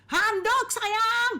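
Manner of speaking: sweet words through irritation; annoyed husband faking sweetness; strained sweetness. Retold or imitated. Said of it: "intonasi berubah".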